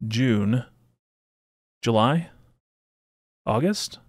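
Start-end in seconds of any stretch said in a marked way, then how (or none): 3.5-4.0 "August" rising high at the end, as a question; unsure questioning. Real, not acted. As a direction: ask "August" with a sharp upward lift, unsure of the month. Unsure recalling the month.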